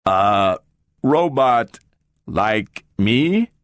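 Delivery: slow, level robot voice with a puzzled edge; a machine turning over a new idea; slow mechanical query. A narrator's reading. The talking robot questions Gloria's words in a slow mechanical voice.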